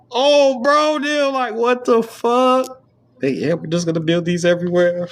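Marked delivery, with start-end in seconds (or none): 0.1-2.8 much higher, slow stunned outcry; can't believe it, drawn out; shocked disbelief. Real, not acted. Exasperated reaction.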